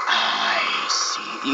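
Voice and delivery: breathy, voiceless sinister whisper; slow, creepy hissed whisper; Sauron. Retold or imitated. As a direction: whisper it like a dark overlord. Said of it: "He sounds like Sauron".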